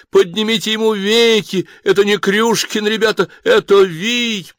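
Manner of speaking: high, loud mock horror call; mock alarm. A narrator's reading. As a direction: raise the alarm in jest. Samsik mocks Krushkin as Viy. (насмешливый громкий крик ужаса)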